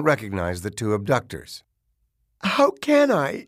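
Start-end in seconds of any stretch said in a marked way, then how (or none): 2.4-3.5 high, slow, pleading whimper. A narrator's reading. Distraught mother to the detectives viewing the tape, whimpering. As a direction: whimper it helplessly, pitched well up. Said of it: "she whimpered".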